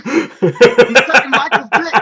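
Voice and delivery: high, slow, laughing jab; mocking laughter, high and loud; laughing tease. Real, not acted. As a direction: cackle through it. Teasing friend cracks up.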